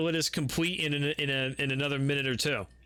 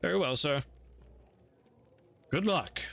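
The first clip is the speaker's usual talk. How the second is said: butler voice; 3 semitones higher than usual. Acted.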